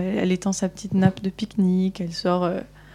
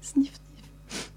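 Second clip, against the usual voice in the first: soft unvoiced sniff sniff of a crying wolf; two small whimpering sniffs, hammed-up; mock sniffling; 6 semitones higher, 11 dB quieter than usual. The hidden wolf cries behind the rock, soft mock sniffles. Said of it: "un petit reniflement et des pleurs".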